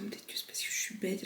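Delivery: whispered worried aside. Real, not acted. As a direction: whisper it to yourself. Self-doubting aside, unvoiced.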